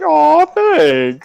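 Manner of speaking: high, sing-song aw thanks; mock touched. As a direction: gush with fake gratitude. Mock gratitude for an insult.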